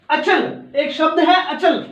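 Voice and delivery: ordinary talk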